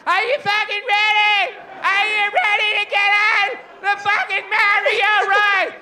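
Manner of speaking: high, loud forced hype; pushy ride attendant. Retold or imitated. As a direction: yell it at a worn-out crowd. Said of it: "And she was going like".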